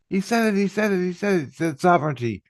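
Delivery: high, rapid mock screams tumbling out; mock screaming. They all react to his word, high mock screaming. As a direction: imitate a crowd screaming the news in a high voice. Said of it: "they all started screaming".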